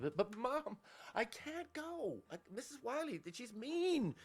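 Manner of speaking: put-on high, drawn-out kid's protest; hammed-up pleading child. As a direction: plead with mom in a high-pitched little kid's whine. Child pleading from farther away.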